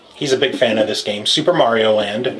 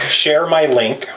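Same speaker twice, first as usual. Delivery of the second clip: slow and deliberate; slow line reading; slower than usual. Shown as a demonstration. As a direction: say it slowly, word by word. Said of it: "saying my lines".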